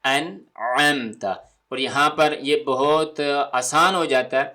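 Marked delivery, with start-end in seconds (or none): 0.0-1.2 انعمت with a throaty ع; clear ain. Shown as a demonstration. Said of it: "عین کی آواز".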